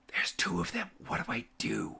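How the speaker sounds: soft, unvoiced aside; panicked whisper. Guard asks his colleague what to do, urgent whisper. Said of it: "he whispers back down again and goes".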